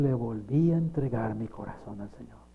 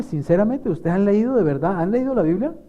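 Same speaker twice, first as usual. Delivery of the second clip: usual speaking voice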